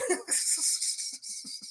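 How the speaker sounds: soft, wheezy high laugh; tickled laugh. Real, not acted. Host delighted by the name drop, laughing.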